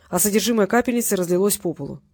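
ordinary delivery

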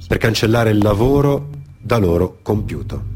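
ordinary delivery

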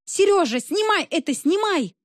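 high urgent shout. A narrator's reading. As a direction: yell at the cameraman. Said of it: "выкрикнула журналистка".

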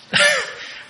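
short high laugh at his own joke; amused preacher laughing; high burst of laughter. Real, not acted.

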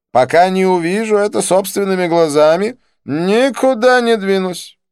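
very high, loud, stubborn declaration; excitable Didier. A narrator's reading. Didier refuses to leave until he sees it, high and stubborn. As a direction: dig your heels in, high-pitched, stretching the final refusal. Didier digs his heels in.

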